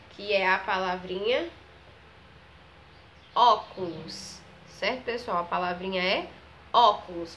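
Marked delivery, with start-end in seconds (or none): 3.3-4.4 ÓCULOS slow, strong open first syllable, long final s; open Ó sound. Shown as a demonstration. Teacher presenting the next word, slow ÓCULOS with open stressed Ó. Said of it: "tem um som mais aberto". 6.7-7.4 ÓCULOS with a loud, open first syllable; open Ó sound. Shown as a demonstration. Teacher repeating the word for pupils, ÓCULOS with loud open Ó. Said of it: "tem um som mais aberto".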